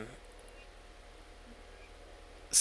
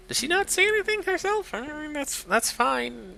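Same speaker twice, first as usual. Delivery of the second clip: character voice. Acted. High put-on voice.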